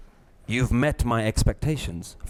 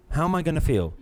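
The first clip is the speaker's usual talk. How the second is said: usual speaking voice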